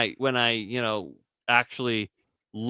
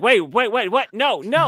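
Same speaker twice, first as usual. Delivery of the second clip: alarmed wife; 6 semitones higher, 4 dB louder than usual. Retold or imitated. Wife alarmed by his request.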